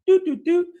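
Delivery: high, loud imitated phone tones; dialing beeps. Acting out Putin phoning the Iranians, mock dial tones. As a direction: mimic the beeps of a phone keypad. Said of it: "He's, like, on the phone".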